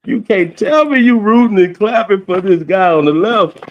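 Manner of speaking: high, loud crowing taunt; loud trash talk. Real, not acted. Boasting about sixty-five points in the Garden, loud trash talk. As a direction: shout it high like courtside heckling.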